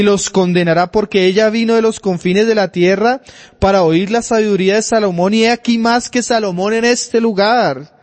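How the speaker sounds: loud, high scripture proclamation; preaching at full force. Acted. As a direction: thunder the verse to the congregation.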